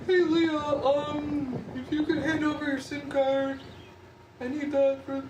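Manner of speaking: high, sustained put-on voice; imitating a coworker's voice. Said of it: "that's literally how she talks".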